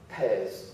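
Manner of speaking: low falling single word after the pause; deadpan reveal. Shown as a demonstration. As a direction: drop the punchline flat. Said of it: "dramatic pause".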